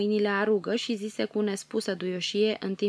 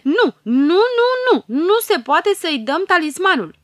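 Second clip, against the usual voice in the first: much higher, louder chanted nos; noisy fairy chorus; 10 semitones higher, 13 dB louder than usual. A narrator's reading. Fairies refusing the talisman, a much higher and louder shout. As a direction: yell the refusal like a mob of little fairies. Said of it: "o aprobară zgomotos".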